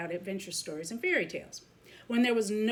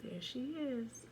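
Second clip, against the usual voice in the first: hushed; 5 semitones higher, 9 dB quieter than usual. Real, not acted. Showing the picture quietly.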